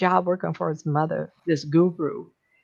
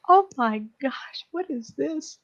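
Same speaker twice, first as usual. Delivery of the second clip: amazed; 7 semitones higher, slower than usual. Retold or imitated. Twenty-two tasting pizza for the first time, high slow amazement. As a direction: marvel at the first taste. Throws her arms out and goes.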